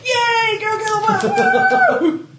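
loud high cheer. Real, not acted. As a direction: cheer on a friend's win. Cheering a teammate's good roll.

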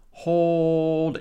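overdone comment voice; drawn-out slang read. Acted. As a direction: read it long and high, mock amazed.

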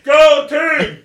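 high, loud pep rally cheer; cheerleader yell. Pretend. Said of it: "the male cheerleader that just yells into that thing".

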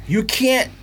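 ordinary talk, nothing marked

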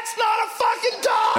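high panicked shout; panicked dog. Retold or imitated. Dog spotting a wolf at the park, high panicked yell. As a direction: yelp it out in alarm. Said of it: "looked at that wolf and ... went".

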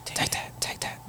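breathy whispered aside; whispering. Pretend. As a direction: whisper it under your breath. Whispered aside during intros.

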